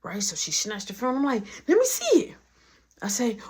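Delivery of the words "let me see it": very high-pitched retold demand; mock demand. Retold demand during phone fight.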